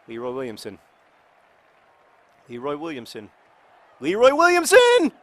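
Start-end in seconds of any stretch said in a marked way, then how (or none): 4.0-5.1 high, loud cry of the name; excited yell. Real, not acted. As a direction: shout the name in hope. Cheering player's chance.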